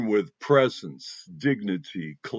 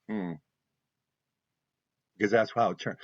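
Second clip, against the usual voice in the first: usual speaking voice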